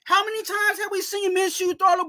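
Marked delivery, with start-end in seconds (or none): 0.0-0.4 angry fan yelling at the coach; exasperated shout. Real, not acted. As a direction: stretch it out like you're yelling at the TV.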